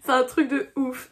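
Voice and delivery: tearful, half laughing; crying. Real, not acted. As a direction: voice choked with tears. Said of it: "moi je chiale".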